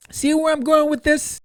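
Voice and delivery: high, loud, teasing question; knowing tease. Real, not acted. Vlogger teasing his viewers, high and loud.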